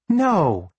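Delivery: a light laughing no; amused, laughing it off. Acted.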